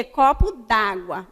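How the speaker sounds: "Copo d'água" said slowly with "d'água" run together as one word; teacher sounds out "d'água" as dágua for the class; contracted d'água. Shown as a demonstration. Teacher models "Copo d'água" slowly, contracted. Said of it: "A pronúncia é copo d'água".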